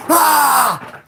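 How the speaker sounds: a sudden loud high "Ah!" yell; shout. Retold or imitated. Snapping at the coworker, a sharp loud shout. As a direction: bark out a startled, angry "Ah!". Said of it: "I was probably like".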